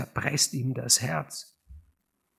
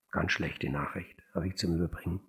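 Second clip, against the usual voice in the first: grim low; 6 semitones lower, 6 dB quieter than usual. A narrator's reading. Edmund brings grim news, low.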